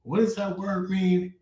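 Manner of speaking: a listener stumped by a word; puzzled listener. Retold or imitated. Rapper imitating a confused fan wondering what a word means. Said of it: "make them question and wonder".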